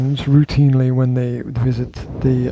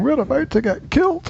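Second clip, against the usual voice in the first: put-on high, soft Scottish accent; 11 semitones higher, 5 dB quieter than usual. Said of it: "I said that in a Scottish accent".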